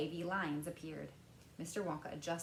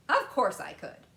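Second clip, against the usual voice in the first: louder, brisk Wonka answer; lightly voiced candymaker; 3 semitones higher, 11 dB louder than usual. A narrator's reading. Wonka answering Mike, a little louder. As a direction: toss off the answer.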